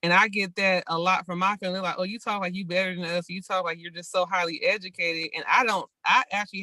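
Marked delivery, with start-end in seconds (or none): none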